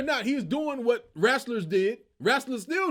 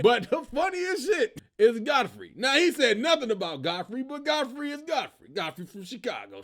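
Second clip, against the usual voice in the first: ordinary talk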